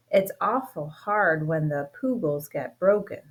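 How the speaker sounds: usual speaking voice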